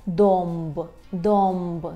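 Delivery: домб drawn out as "domb"; "om" before b. Shown as a demonstration. Teacher models the Polish word for oak, домб with om. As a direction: hold the "om" in домб, then the b. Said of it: "Вимовляємо її як ом".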